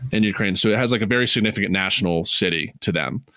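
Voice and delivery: ordinary talk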